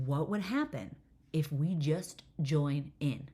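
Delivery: spaced-out, emphatic question; slow, deliberate repeat. Retold or imitated. Mom repeating herself to a puzzled daughter, slow and deliberate. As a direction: spell the idea out slowly, word by word.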